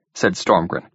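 ordinary delivery, nothing marked

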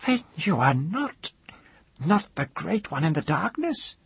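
high, puzzled woman's voice; frowning woman. A narrator's reading. Woman doubting the mage, high and frowning. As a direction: question him, high and doubtful. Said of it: "she said, frowning".

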